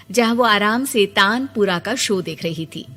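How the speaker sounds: ordinary delivery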